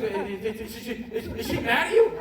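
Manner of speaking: imitating a stuttering, nervous friend; hesitant, repeating mock stammer. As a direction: stammer into the question, ending high. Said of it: "Because he was stuttering".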